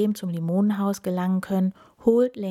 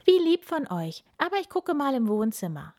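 grinning; 7 semitones higher than usual. A narrator's reading. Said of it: "grinst er".

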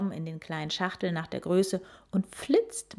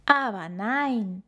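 grinning; 4 semitones higher, slower than usual. A narrator's reading. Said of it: "sagt Oma grinsend".

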